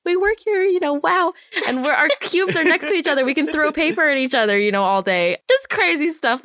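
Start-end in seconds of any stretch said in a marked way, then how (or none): 0.0-1.3 high, giggly wow; new hires amazed they work at the studio, giddy; giddy awe. Retold or imitated. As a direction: marvel like a giddy kid.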